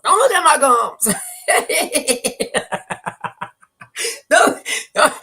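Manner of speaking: loud, high laughing plea. Real, not acted. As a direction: laugh while begging them. Laughing, embarrassed about gums.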